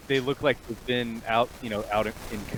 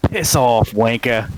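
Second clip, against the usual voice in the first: louder, spat-out insult; defiant curse; 9 dB louder, slower than usual. Acted. Tied-up prisoner cursing the captain, loud and defiant. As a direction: snap it at your captor, loud.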